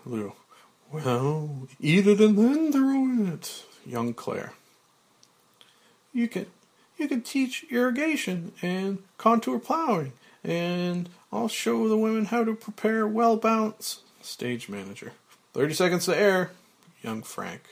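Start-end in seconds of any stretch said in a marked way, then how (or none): 0.9-3.7 loud, high, drawn-out retort; Lou Wexler. Acted. Lou answers young Frank, loud high drawn-out voice. 6.1-14.0 put-on very high, eager young woman's voice; young Claire. Young Claire plans their Peace Corps work, high eager voice. 15.5-16.5 quick, louder, higher cue; stage manager. Acted. Stage manager warning the set, quick loud countdown call.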